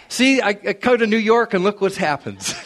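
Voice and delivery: high-pitched, amused, through a laugh. Real, not acted. Preacher joking about his New York trip, laughing. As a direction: laugh at your own outburst as you talk.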